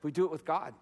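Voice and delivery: low, soft restatement; hushed emphasis. Real, not acted. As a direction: let the point land quietly. Hushed repeat of serious point.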